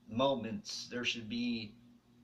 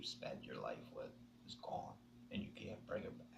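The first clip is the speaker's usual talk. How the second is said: whispered grief; 9 semitones lower, 13 dB quieter than usual. Real, not acted. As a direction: voice collapsing into a broken whisper. Grief over lost partner.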